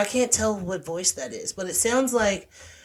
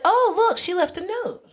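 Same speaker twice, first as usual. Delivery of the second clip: mock surprise; 12 semitones higher, 3 dB louder than usual. Staff find the note.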